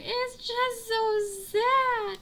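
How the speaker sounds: high, slow drawn-out mock sob voice; mock teary mom. Mom answering daughter during nine one one, mock weepy and slow. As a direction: stretch it out like a teary mother. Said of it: "my mom would cry".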